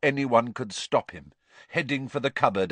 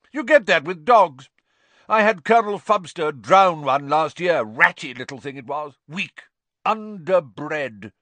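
higher, scornful aunt character voice; tipsy overbearing aunt; 6 semitones higher, 5 dB louder than usual. A narrator's reading. Aunt likening the boy to runt dogs, scornful. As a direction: pronounce judgement like a dog breeder.

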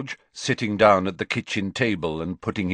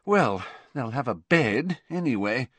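higher, mildly flustered; slightly harassed; 5 semitones higher than usual. A narrator's reading. Mother stretched by guest arrangements, slightly harassed. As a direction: brush it off, a touch harried. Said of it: "sounding slightly harassed".

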